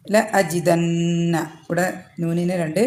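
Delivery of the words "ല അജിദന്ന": അജിദന്ന with the nasal held two counts, very slow; nasalized recitation. Shown as a demonstration. Tajweed teacher showing students the held nasal ghunnah. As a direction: hum the ന through two beats. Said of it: "മണിച്ചിട്ട് pronounce ചെയ്യാം".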